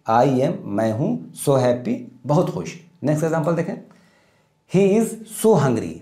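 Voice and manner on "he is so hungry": slow dictation. Shown as a demonstration. Teacher dictating model sentence.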